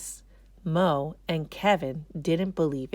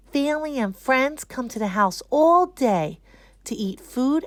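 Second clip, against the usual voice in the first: put-on higher, louder cheerful child voice; proud kid presenter; 5 semitones higher, 5 dB louder than usual. A narrator's reading. Boy tells his class about Eid, cheerful and proud. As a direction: share your holiday proudly with the class.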